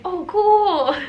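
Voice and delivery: high, loud put-on little sister voice; imitating her sister. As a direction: gush it like the sister in a crystal shop. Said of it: "I could just hear her being like".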